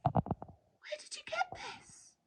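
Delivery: high, slow, soft shocked whisper; a gasp, then a breathless whispered question. A narrator's reading. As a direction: barely get the words out for shock. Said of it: "she asked breathlessly".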